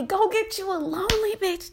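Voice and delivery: high, sassy brush-off; mock dismissive. Real, not acted. Brushing off men who message her, sassy and high. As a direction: shoo them off to someone lonelier.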